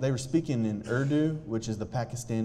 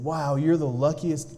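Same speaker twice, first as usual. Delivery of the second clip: retold excitement; 5 semitones higher, 6 dB louder, slower than usual. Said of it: "really excited".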